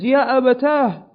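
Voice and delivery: high, loud, drawn-out cry; retold child's scream. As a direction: cry out like a child calling for father. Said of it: "So the child screams".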